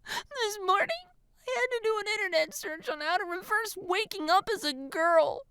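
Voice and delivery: high, thin whiny voice; distressed girl. A narrator's reading. Tearful girl to friend, distressed. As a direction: complain in a high girlish pitch.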